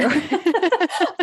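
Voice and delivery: a short, high burst of real laughter; bright real giggle. Real, not acted. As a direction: laugh freely at the cheesy line. Guest laughs at host's remark.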